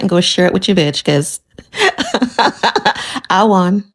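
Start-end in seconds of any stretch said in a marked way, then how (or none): 1.8-3.2 triumphant laugh. Real, not acted.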